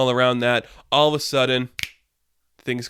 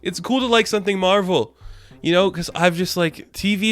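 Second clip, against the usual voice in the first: excited; 8 semitones higher than usual. Real, not acted. Gushing, laughing fan.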